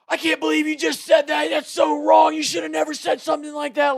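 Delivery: high-pitched outraged mock screaming; retold screaming. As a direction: imitate scandalized jocks all yelling. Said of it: "So they're screaming at us".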